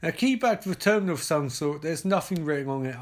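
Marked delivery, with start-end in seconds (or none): none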